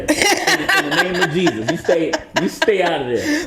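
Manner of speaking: high-pitched, laughing plea; mock warning. Guest warning ladies away from his DMs, mock-pleading and amused. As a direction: playfully beg them off, through laughter.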